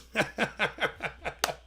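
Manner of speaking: high, staccato cackling laugh; derisive laugh. Real, not acted. Speaker ridicules a politician's mistaken name, derisive laugh.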